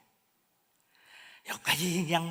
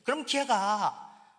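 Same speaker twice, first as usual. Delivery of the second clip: ordinary talk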